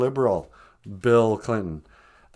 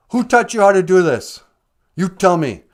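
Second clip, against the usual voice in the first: angry dad; 6 semitones higher, 8 dB louder than usual. Retold or imitated. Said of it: "the dad".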